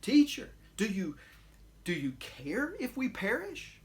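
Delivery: rebuking question. Acted. Disciples rebuke their sleeping teacher in the storm, reproachful. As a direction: say it as a mild rebuke, hurt and accusing. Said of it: "interpreted as a rebuke".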